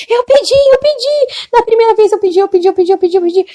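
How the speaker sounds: very high cry, gradually coming down; imitated frantic pleading. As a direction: insist desperately, repeating it in a high wail. Young woman pleads before judgment. (imitando súplica desesperada e repetida)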